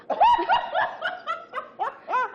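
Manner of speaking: a long, high burst of real laughter. Real, not acted. Laughing hard at the vendor's formal reply, long and high.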